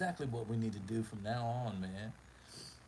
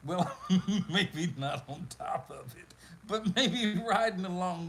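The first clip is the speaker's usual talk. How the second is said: louder, laughing as he corrects himself; 7 semitones higher, 7 dB louder than usual. Real, not acted. Host correcting his own rude joke while laughing loud. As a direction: push through the giggles, louder.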